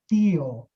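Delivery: Tio ending in a full clear o, not u; teaching the final o; Spanish o. Shown as a demonstration. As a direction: say Tio with a round o at the end. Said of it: "som do O".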